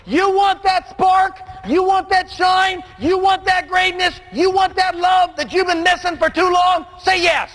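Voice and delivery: stage preacher building to the crowd's yes; full-force preaching. Acted.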